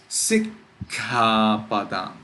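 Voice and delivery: Sikkhapadam slow, stopped at sik before khapadam; pause on double consonant. Shown as a demonstration. Teacher giving an example, Sikkhapadam with a held k. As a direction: hold the kk of Sikkhapadam, then release. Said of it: "It is sikkhapadam".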